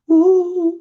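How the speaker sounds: a high, loud seal-like howl, cut short; imitated seal howl. Shown as a demonstration. Lecturer imitates a seal mum howling at another mum, high and loud. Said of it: "you might hear them going".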